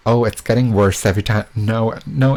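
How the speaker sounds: normal talking voice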